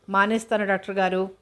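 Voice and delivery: ordinary delivery, nothing marked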